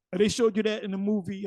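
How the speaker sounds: ordinary talk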